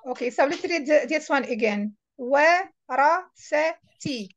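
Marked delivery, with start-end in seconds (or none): 2.2-4.3 "Warasati" very slowly, split as wa, ra, sa, ti; teacher's slow, spaced "Warasati"; syllable by syllable. Shown as a demonstration. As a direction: sound out "Warasati" one syllable at a time. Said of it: "read it alphabet by alphabet".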